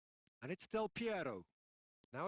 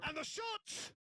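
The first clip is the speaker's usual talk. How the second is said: excited shot call; 13 semitones higher than usual. Real, not acted. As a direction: voice jumps high on the shot. Commentator calls a shot.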